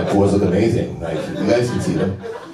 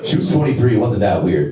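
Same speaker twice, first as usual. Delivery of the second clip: ordinary talk, nothing marked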